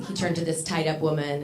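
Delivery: usual speaking voice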